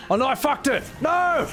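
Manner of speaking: high-pitched cry of comic dismay; dismayed outcry. Real, not acted. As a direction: yelp it as you botch the move. Botches the hopscotch in game.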